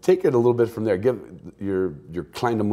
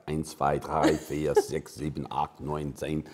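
low-pitched German-accented counting; 5 semitones lower, 3 dB quieter, slower than usual. Shown as a demonstration. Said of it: "I can do a good German accent".

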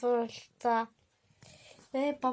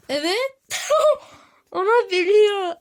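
laughing shriek; 7 semitones higher, 12 dB louder than usual. Real, not acted.

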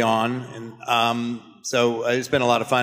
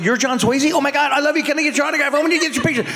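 much higher, breathless starstruck fan squeal; imitating an awestruck crowd member; gushing fan; 14 semitones higher, faster than usual. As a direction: gush like a fan who just spotted a star. Starstruck fans recognizing him.

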